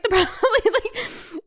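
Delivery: normal talking voice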